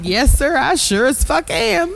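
high-pitched Southern twang; put-on Southern belle twang. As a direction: play up a Southern accent, pitch climbing. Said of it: "with a Southern twang".

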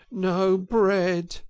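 high, mournful groan; dolorously groaned. A narrator's reading. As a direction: groan it in misery. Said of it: "groaned the Mole dolorously".

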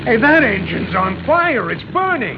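rising panic, pitch jumping up; sudden fear breaking into a high cry; alarmed shout. Acted. Passenger spots engine fire.

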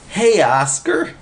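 high, drawn-out holler. A narrator's reading. Willie calls Oscar over, hollering. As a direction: call out to a pal. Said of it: "he hollered".

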